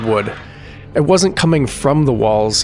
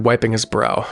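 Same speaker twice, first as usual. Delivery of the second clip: plain reading voice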